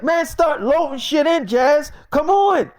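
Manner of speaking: high, loud hurry-up yell; retold shout hurrying Jazz along; impatient shout. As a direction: bark at her to move. Mike yelling at Jazz.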